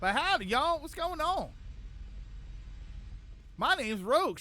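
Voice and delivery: lightly put-on Southern accent. Shown as a demonstration. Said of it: "Rogue's is Southern".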